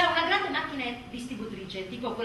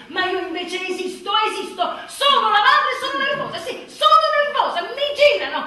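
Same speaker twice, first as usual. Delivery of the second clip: much higher, louder rant with laughter; comic rant; 9 semitones higher, 12 dB louder than usual. Acted. Nervous mother asserting herself, loud comic rant. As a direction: rage it out, half laughing.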